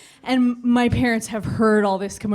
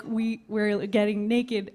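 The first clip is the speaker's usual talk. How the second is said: ordinary talk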